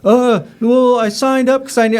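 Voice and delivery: high, loud flustered student voice; hammed-up nervous recruit voice; mock timid student. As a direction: squeak it out like a caught student.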